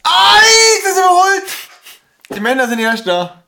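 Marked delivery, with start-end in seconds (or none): 0.0-1.4 high, loud exclamation; shocked outcry. Real, not acted. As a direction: blurt it out in shock. Shocked at men overtaking women.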